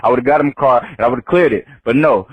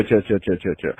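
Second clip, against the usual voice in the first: low repeated plea, fading softer; calming down; 8 semitones lower, 7 dB quieter than usual. Retold or imitated. Telling her to stop approaching, low fading calm. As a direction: back off while soothing, dropping away.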